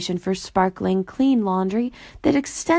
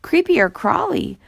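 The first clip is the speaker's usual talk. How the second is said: feigned innocence, pitched up high; innocently; 8 semitones higher, 3 dB louder than usual. A narrator's reading. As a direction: play dumb, all innocence. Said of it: "Boober replied innocently".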